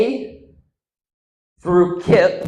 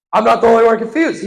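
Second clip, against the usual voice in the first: fast, loud, high-pitched insistence; quick emphatic repeat; 7 semitones higher, 8 dB louder, faster than usual. Shown as a demonstration. Comic insisting to the crowd, quick and emphatic. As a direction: rattle it out louder and higher. Repeats the line two ways.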